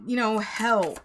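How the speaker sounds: ordinary talk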